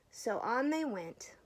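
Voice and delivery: plain reading voice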